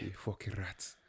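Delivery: joking Irish impression, dropped in volume; attempted Irish accent. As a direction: mutter it in a badly faked Irish voice. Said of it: "can't do an Irish accent".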